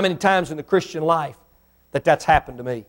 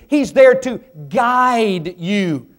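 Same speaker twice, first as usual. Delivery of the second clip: ordinary talk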